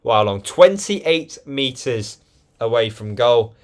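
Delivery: lively football play-by-play; match commentator voice; live commentary. Acted. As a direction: call the match as it unfolds.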